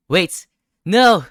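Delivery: sudden very loud, very high panic; real shock at what he sees; shrieked denial; horrified cry. Real, not acted. Horrified at the discovered scene.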